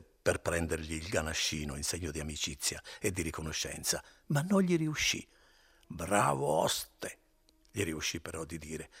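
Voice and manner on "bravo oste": put-on drunken slur. Drunk Renzo thanks innkeeper.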